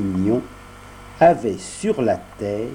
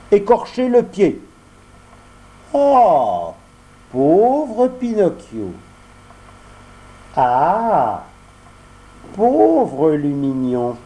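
higher, slow mock pity; voix d'enfant; 5 semitones higher, 3 dB louder, much slower than usual. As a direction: boys faking sympathy. Les deux amis se plaignent. (fausse pitié enfantine)

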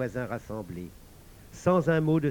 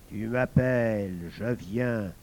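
hellish creature; 4 semitones lower, slower than usual. A narrator's reading. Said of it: "dit la chose d'enfer".

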